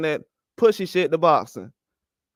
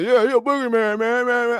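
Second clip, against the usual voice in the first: mocking the hype crowd; 4 semitones higher than usual. Boxing streamer mocks fans hyping Matias, in a high mocking voice. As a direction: parrot the fans calling him a boogeyman. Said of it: "And now people talking about".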